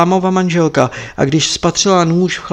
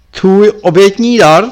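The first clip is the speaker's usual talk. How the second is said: louder, higher scorn leaning on obětní; scornful Lama; 5 semitones higher, 9 dB louder than usual. Acted. Lama scorns the monk's sacrifice, leaning on obětní. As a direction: hit obětní with contempt.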